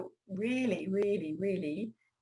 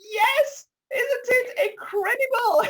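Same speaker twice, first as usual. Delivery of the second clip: excited; 12 semitones higher, 6 dB louder, slower than usual. Real, not acted. Host enthuses about environment.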